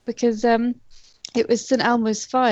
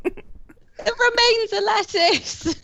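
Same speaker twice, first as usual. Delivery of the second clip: drawn-out high punchline; slow punchline; 10 semitones higher, much slower than usual. Pretend. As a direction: stretch out the pun slowly.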